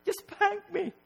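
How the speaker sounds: very high, strained mock-crying squeal; exasperated child. Child sick of the timeout lecture, high exasperated whine. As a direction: beg in a tiny, pained child's voice. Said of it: "and you're just like".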